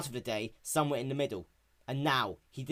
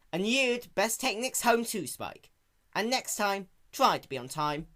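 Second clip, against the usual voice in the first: put-on firm young mare; 9 semitones higher, 4 dB louder than usual. A narrator's reading. Applejack scolding Spike.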